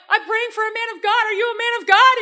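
reliving a tearful, urgent prayer out loud; retold crying plea. Retelling meeting the stranger at breakfast, tearful and pleading. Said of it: "But I'm, like, crying".

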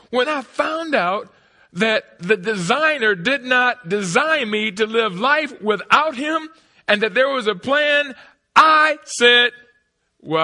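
loud, high, stretched-out sermon shouting; full-force preaching. Real, not acted. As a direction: preach it to the rafters.

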